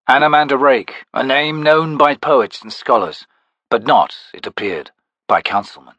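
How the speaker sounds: ordinary delivery, nothing marked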